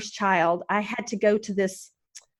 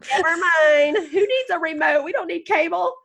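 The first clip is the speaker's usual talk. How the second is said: laughing dismissal; 7 semitones higher than usual. Retold or imitated. Joking self-mockery, laughing.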